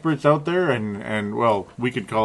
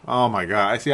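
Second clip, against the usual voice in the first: usual speaking voice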